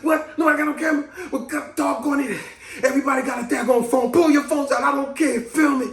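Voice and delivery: high, insistent rant to the room; retold hollering. Drugged patient demands that everyone film him, high hollering. Acts out hollering at hospital staff.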